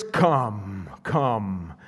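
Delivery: deep low rumbling come, come; thunderous come. Shown as a demonstration. Preacher demonstrating the creature's call, deep and rumbling. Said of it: "that thunderous".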